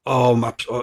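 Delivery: ordinary talk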